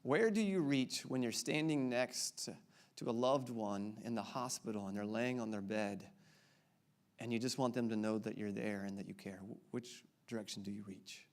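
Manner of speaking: low, soft, somber question; quiet, tender and heavy-hearted; sadder. Acted. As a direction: sink gently into a sad hush. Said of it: "It's getting sadder, isn't it?".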